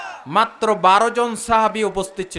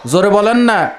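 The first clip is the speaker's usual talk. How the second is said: raised-voice demand to the crowd; loud prodding; 6 dB louder than usual. Real, not acted. Preacher wanting the day said loudly, loud prodding. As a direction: push them to answer louder.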